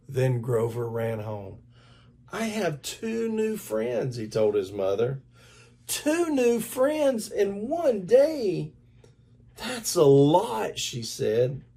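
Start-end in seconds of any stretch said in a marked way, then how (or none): 2.3-4.2 put-on higher, slow happy boy; proud news. Boy tells mother news. 5.9-10.8 much higher, slow delighted mom; amazed mother. Acted. Mother marvels at news.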